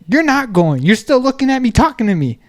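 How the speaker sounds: high insistence building to a loud command; retold heated order. Worker ordering jet skier to move, retold heated order. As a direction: mimic an argument, ending in a high shout.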